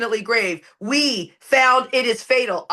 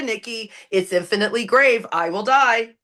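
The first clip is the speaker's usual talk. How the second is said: normal talking voice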